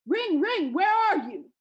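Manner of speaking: high, loud giant's holler; calling out. A narrator's reading. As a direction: call out in search, swooping down. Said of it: "the giant called out".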